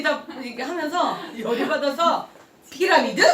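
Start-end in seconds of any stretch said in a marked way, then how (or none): 2.7-3.2 louder, offended echo of the word; indignant retort. Retold or imitated. To the prospect who called it a pyramid, an offended retort. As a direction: throw the word back, stung.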